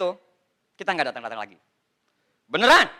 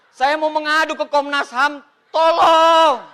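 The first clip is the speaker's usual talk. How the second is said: loud, higher, laughing desperate help; hammed-up plea; 5 semitones higher, 6 dB louder, slower than usual. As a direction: beg theatrically for rescue. Mock plea to rights commission. (memohon tolong secara berlebihan sambil tertawa)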